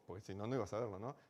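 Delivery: ordinary talk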